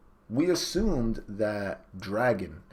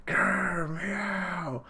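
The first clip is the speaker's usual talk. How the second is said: mock cat growl; 10 semitones higher than usual.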